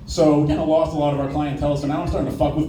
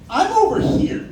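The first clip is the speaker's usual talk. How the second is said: mock calling out; slower than usual. Calling out to drive-through window.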